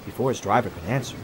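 usual speaking voice

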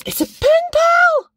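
squeals it out loud and high, slowly; excited shout. Real, not acted. Unboxing a gift, she shouts with real delight. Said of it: "I realised I was shouting then".

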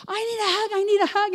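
high-pitched excited imitation of a friend; mock-excited, high and eager; eager plea. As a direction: call it out high, running in for a hug. Friend runs over begging hug.

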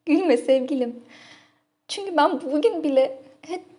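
high, loud, flustered confession; bashful pleading. Acted. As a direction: protest shyly on the heels of a laugh. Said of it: "utanç duyuyorum".

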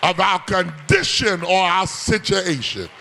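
whooping sermon cadence, half sung over the organ; preaching. Acted. Preacher to the congregation, sung whooping cadence. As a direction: preach it, rising to a sung holler. Preaching at full force with music.

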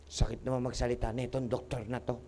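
low, soft mimicked grumble; quiet imitation of a wounded patient; muttered patient complaint. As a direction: grumble under the breath as an offended patient. Voicing a hurt patient's muttered complaint.

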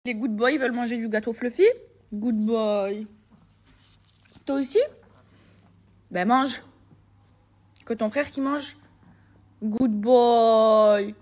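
loud, cooing pet talk; loud doggy praise; talking to the dogs. Real, not acted.

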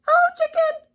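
cheery duck voice. A narrator's reading. Duck calling out to Cow as he rides past, cheery duck voice. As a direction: call out high and loud as a little duck. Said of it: "said Duck".

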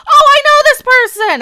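loud, high-pitched exclamation; sudden recognition. Real, not acted. YouTuber spots a troll they have blocked, exclaiming in recognition. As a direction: burst out as you recognize the culprit.

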